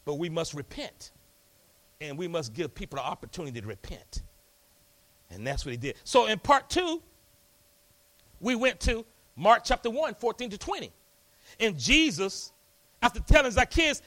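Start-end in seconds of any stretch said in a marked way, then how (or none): none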